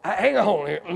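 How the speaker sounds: ordinary talk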